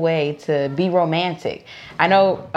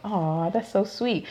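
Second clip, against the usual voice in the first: soft slow touched aw, much quieter; 8 dB quieter, slower than usual. Retold or imitated. Reading her husband's sweet text, softly touched. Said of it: "It's like".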